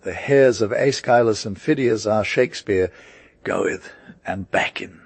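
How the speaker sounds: ordinary delivery, nothing marked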